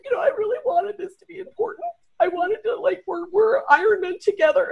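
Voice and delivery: high, whimpering imitation of tears; mock crying. Teammate falling apart on day one, mock crying. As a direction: plead through put-on sobs. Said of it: "And then she starts crying".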